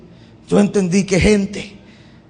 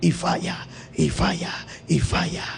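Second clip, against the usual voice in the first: hushed and breathy, dying away; a near-silent, falling repetition; fading whisper; 5 semitones lower, 12 dB quieter than usual. Real, not acted. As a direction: let each "y falla" sink to a whisper. Preacher repeats the word softly.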